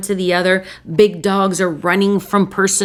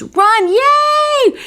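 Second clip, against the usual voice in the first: gleeful cheer; 5 dB louder than usual. Pretend. Urging family to greet partner.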